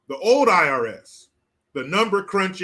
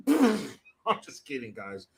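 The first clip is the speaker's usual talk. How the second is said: joking aside; 4 semitones lower, 5 dB quieter, slower than usual. Retold or imitated. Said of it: "He didn't go".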